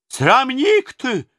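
put-on slow, high, loud old woman shrieking "Срамник ты!"; old nurse yelling at the tsar, drawing out "Срамник ты!"; furious shout. A narrator's reading. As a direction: drag out "Срамник ты!" in an outraged shriek. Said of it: "закричала она на Иоанна".

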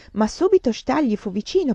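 plain reading voice

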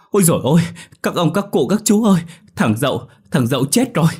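high, loud anguished wail; wailing shout. A narrator's reading. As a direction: cry out in desperation to the elders. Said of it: "Tấn lại gào lớn".

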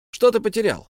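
high, loud demand. A narrator's reading. Bilbo demands what Gollum lost. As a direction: press him hard.

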